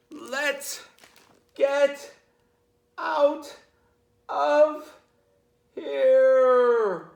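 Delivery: high strained cry, words dragged out one by one; choking burglar shout. Acted. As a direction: gasp it out as if choking on the smell. Choking burglars flee gas cloud.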